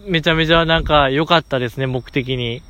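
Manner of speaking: usual speaking voice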